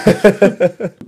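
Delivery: high burst of real laughter. Real, not acted. Laughing after telling the host to trust Mohammed Phillip. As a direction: laugh at your own joke.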